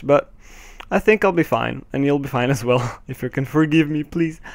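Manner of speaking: normal talking voice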